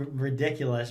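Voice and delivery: ordinary talk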